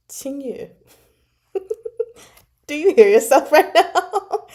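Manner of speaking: mock disbelief, high and laughing; incredulous. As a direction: ask it like they've lost it. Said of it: "And I was like".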